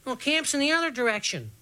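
put-on high-pitched boy's objection; young gang member objecting; Dragonfly. A narrator's reading. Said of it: "Dragonfly said".

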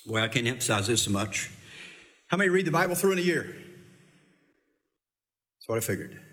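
usual speaking voice

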